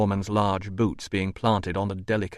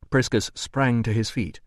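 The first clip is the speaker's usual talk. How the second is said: ordinary delivery, nothing marked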